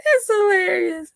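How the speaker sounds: squeaky laugh through the words; tickled squeal; high giggle. Real, not acted. Laughing at robot question.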